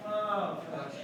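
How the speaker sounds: ordinary delivery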